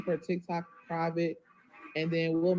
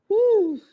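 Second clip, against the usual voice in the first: loud emotional whew; 12 dB louder than usual. Real, not acted. Overwhelmed exhale.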